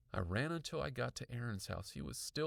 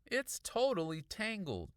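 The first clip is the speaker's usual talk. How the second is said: put-on high boy's voice, grumbling; 9 semitones higher, 4 dB louder than usual. A narrator's reading. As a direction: complain in a high kid's voice. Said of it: "Aaron muttered".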